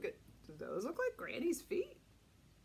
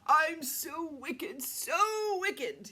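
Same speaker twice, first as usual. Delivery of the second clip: gloating wolf; 3 semitones higher, 4 dB louder, much slower than usual. A narrator's reading. Wolf gloats after eating.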